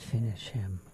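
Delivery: deep, booming game announcer; Mortal Kombat voice. Pretend. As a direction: growl it like the fight announcer. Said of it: "Is that from Mortal Kombat?".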